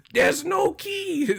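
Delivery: very high, drawn-out mock complaint; hammed-up high-pitched impression. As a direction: squeal it in exasperation, on the edge of laughing. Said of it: "He was like".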